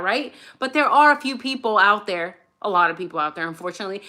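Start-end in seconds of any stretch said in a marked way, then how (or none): none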